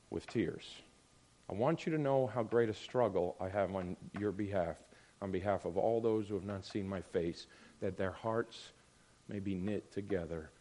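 low, very soft, measured reading; hushed, earnest apostle's words; solemn scripture recitation. Acted. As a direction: recite scripture tenderly, with pauses between phrases.

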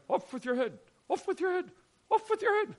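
high-pitched mock dictator's decree; imitating a dictator's shrill command. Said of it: "dictators sitting there going".

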